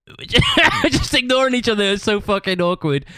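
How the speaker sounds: slowed, high laughing delivery. Real, not acted. Recalling ignoring the bandmate at practice, laughing. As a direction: laugh helplessly at the awkwardness.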